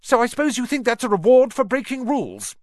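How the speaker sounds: put-on high, loud disapproving girl; angry. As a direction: scold them sharply. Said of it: "came an angry voice".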